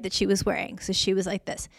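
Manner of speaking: normal talking voice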